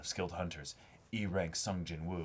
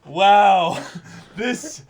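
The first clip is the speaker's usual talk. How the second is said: high, loud and slow; excited shout; 14 semitones higher, 17 dB louder, slower than usual. Real, not acted. Praising the series, shouting in delight. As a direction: exclaim it with delighted awe.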